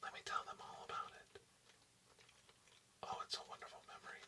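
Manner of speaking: ordinary delivery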